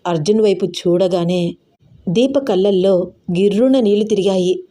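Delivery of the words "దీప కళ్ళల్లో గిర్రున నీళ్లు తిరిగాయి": ordinary delivery, nothing marked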